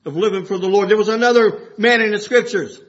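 normal talking voice

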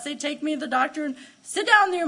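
normal talking voice

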